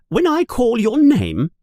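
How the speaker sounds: a strict teacher addressing new pupils; a hint of a stern professor; Professor McGonagall. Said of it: "she says".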